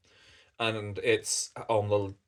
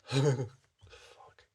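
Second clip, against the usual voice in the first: laughing whisper; 4 semitones higher, 3 dB louder than usual. Real, not acted. Laughing aside at own voice.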